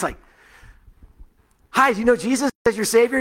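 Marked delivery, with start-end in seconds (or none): 1.7-3.2 high, loud eager blurt; nervous teen jumping straight in; a bit too bright and sudden. Retold or imitated. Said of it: "just bam, just right to it".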